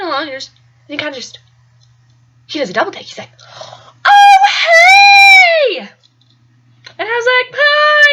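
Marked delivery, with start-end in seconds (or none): 4.0-5.9 very high, loud, long-drawn hail; booming mock-enthusiastic hello; delighted greeting. Boy's greeting on recognising her. 7.6-8.1 high, loud singsong hi; embarrassed, stretched-out hi; awkward greeting. Retold or imitated. Her awkward reply acted out.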